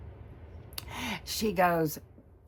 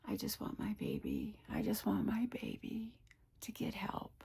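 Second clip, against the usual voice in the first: choked whisper; 3 semitones higher, 7 dB quieter than usual. Real, not acted. Mother worrying about her daughter.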